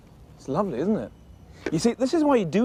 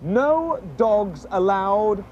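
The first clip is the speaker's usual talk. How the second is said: No dogs allowed read slowly and deliberately; reading a sign aloud; much slower than usual. Shown as a demonstration. Reading the shop sign slowly to Sweep. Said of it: "it says down there on the sign".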